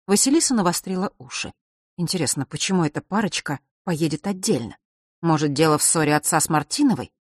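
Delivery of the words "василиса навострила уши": ordinary delivery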